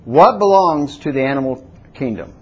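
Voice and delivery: usual speaking voice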